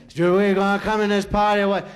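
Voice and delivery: loud, pushy demand; gang leader demanding. Retold or imitated. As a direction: shove your way in with the words.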